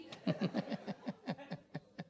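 real drawn-out laugh. Real, not acted. Preacher amused by his joke on their church, laughing. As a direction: burst out laughing at your own joke.

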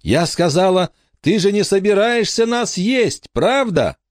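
put-on very high, piercing child's demand; high-pitched mock yell to a deaf giant; insistent loud repeat. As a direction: repeat it insistently at the top of a girl's range. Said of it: "Не так громко".

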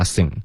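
"sing" in one syllable, where seeing is meant; collapsed syllable. Retold or imitated. Teacher shows the learner's likely mistake, one-syllable sing. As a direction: squash seeing into a single "sing". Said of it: "你就会发sing".

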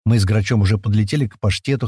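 usual speaking voice

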